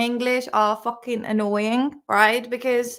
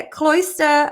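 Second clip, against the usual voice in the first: cloister savored slowly, with a louder lift; relishing favorite word; 5 semitones higher, 6 dB louder than usual. Real, not acted. Teacher relishing her favorite word, slow and delighted. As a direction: linger on cloister like a treat. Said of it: "my all-time favorite word".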